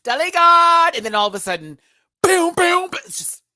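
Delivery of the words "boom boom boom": high, loud "boom boom" sound effect; booming sound imitation. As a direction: mimic a sudden blast of noise.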